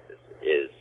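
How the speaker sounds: hushed late night DJ; soft radio voice. Pretend. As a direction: murmur it like a slow jam host. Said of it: "I got my radio voice on right now".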